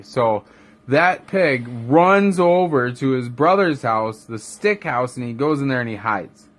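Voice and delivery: ordinary delivery, nothing marked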